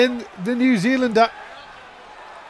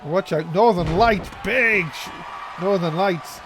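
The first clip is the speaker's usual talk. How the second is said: ordinary delivery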